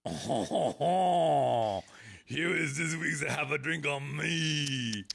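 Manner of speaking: hammed-up French accent; overdone French host voice. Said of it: "which is the best French accent I can possibly do".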